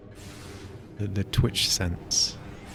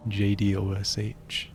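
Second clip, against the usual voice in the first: ordinary talk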